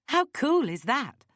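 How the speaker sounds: high, loud, drawn-out delight; thrilled. Acted. Thrilled after singing with the band.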